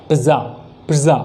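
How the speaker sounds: "पिज़्ज़ा" with a puff on the p; vibrating p. Shown as a demonstration. Teacher demonstrating the sound of p, vibrating p. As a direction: say "पिज़्ज़ा" with the p let go in a slight buzz. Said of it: "पी में थोड़ा सा vibration".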